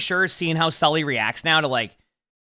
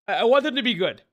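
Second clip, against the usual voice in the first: talking through a yawn; words stretched by a yawn; 7 semitones higher than usual. Real, not acted. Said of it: "Sorry for the yawn".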